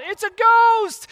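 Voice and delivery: high, drawn-out mock cry of terror; mock scream. Peter recalls spotting a ghost at sea, high mock scream. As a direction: cry it out in hammed-up fright, pitch leaping. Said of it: "we screamed out".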